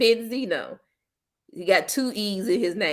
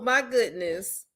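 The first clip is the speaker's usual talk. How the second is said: ordinary delivery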